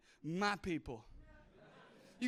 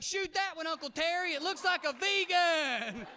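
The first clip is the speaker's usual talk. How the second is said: louder and higher, egging him on; excited cheering; 6 semitones higher, 6 dB louder than usual. Pretend. Nephew urging Uncle Terry on the boat, gleeful loud cheer. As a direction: whoop at your uncle with glee. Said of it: "I'll be like".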